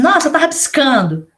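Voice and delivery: hammed-up shocked exclamation; mock dismay. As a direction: act the startled parent seeing scribbles. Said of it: "Aí você vai assim".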